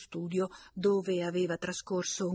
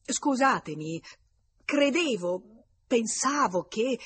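louder, higher, slow halting apology; stammering; 5 semitones higher, 6 dB louder, slower than usual. A narrator's reading. Red-faced visitor apologising to his friend, stammering and strained. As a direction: falter from word to word, embarrassed. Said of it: "continuava a incheccarsi".

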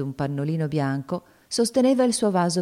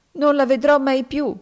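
put-on higher, louder sobbing girl; grieving cry; 10 semitones higher, 5 dB louder than usual. A narrator's reading. Topsy grieving for Eva, sobbing.

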